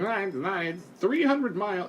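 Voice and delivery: high mock nitpicker; whiny know-it-all correction; mocking tweeter. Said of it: "Don't tweet me and be like".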